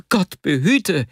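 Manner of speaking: high, startled cry of fear; cry of dismay. A narrator's reading. Vasja recoiling from his friend's offer, alarmed cry. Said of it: "schrie Vasja auf".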